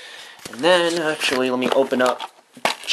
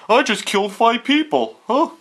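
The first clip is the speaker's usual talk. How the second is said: voicing the hero; 10 semitones higher than usual. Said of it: "He's like".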